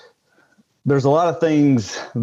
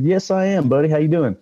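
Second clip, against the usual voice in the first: higher, friendly put-on hello to a worker; cheery greeting; 6 semitones higher than usual. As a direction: keep him happy with a breezy hello. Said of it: "I said".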